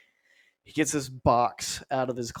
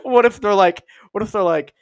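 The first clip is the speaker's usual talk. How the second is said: usual speaking voice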